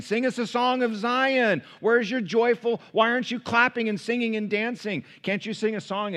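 high, loud mocking jeer; goading, high-pitched captor's tease; taunting, teasing. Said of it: "taunting them and teasing them".

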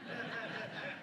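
a quick breathy laugh; short laugh. Real, not acted. Pastor recalling swim with dad, short laugh. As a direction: laugh at the memory.

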